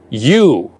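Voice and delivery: You set apart, low and weighty; You given full weight alone; emphatic You. Shown as a demonstration. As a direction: lean hard on You as its own word. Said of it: "It could not be more emphatic".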